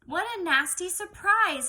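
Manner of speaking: ordinary talk, nothing marked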